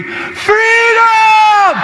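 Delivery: huge high shout, held long; Mel Gibson battle cry. Retold or imitated. Priest leading the crowd in a Braveheart cry, roaring. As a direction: scream it like a rebel warrior. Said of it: "a little bit like Mel Gibson".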